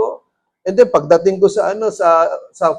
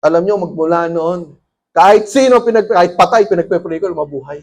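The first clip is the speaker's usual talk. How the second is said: ordinary delivery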